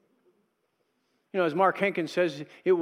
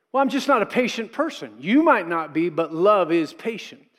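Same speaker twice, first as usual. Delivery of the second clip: normal talking voice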